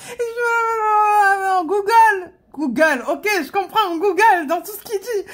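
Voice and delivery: high laughing disbelief; amused, laughing recollection. Real, not acted. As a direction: laugh as you catch the one word. Laughs recalling catching the word Google.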